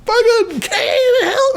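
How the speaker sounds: high, drawn-out hammed-up scream; mock cry for help. Imitating Jeffrey with the cat on his back, mock screaming. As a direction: yell in pretend agony, laughing through it.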